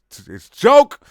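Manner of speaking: yelled insistence; shouting. Acted. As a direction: bellow it out.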